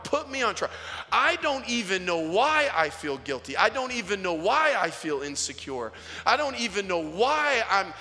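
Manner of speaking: impassioned scripture prayer from the pulpit; fervent preaching. Acted. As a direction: plead it out, leaning hard on key words. Preaches the psalm as a fervent plea.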